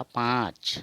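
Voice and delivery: पाँच with the aa through the nose; nasal pronunciation. Shown as a demonstration. Teacher explaining nasal pronunciation, nasalised long aa. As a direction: send the vowel of पाँच into the nose. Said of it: "नाक से उच्चारण होगा".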